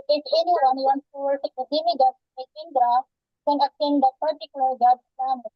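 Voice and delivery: ordinary delivery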